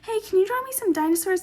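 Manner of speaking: high-pitched mock commenter voice; random commenter. Random dude asking for drawings, mock high voice. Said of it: "some random dude commented".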